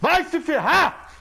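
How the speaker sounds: drawn-out furious yell; raging gamer. Real, not acted. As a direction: lash out at the game. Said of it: "ele é o cara dos rage".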